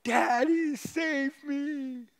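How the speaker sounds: put-on high, loud child's cry for help; cried out. Preacher voicing his daughter crying out to daddy, loud high cry. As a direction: wail it like a frightened little girl. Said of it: "she cried out from the top of her voice".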